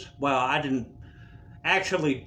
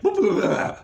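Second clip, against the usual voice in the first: loud blubbering heart sputter; imitated heart noise; 3 semitones higher, 6 dB louder than usual. Patient showing what his heart did, loud sputter. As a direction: sputter loudly like a failing heart. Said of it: "my heart went".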